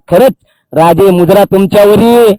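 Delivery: normal talking voice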